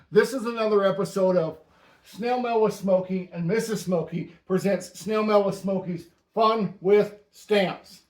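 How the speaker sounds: ordinary talk, nothing marked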